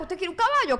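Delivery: grandma offering a horse, doting. Pretend. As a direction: coo it like a grandma spoiling a grandson.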